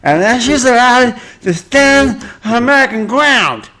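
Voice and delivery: high, loud mock drunk celebrity; hammed-up indignant drunk woman; mocking drunk Reese. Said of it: "Quote to the officer".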